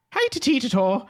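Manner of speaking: very high, squeaky mock-Scottish leprechaun; bad leprechaun accent. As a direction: chirp it in a badly faked Scottish accent. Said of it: "They're also a leprechaun".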